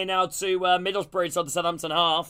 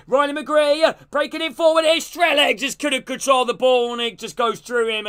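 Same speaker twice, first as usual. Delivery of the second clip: live play-by-play; 4 semitones higher than usual. Acted. Calling the match for stream viewers, rising excitement. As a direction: call the attack as it breaks forward.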